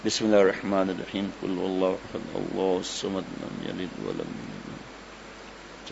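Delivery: demonstrating a protective recitation; Quran recitation. Shown as a demonstration. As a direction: recite the surah softly, slowing down. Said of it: "تینوں کل پڑھ لیں تین تین بار".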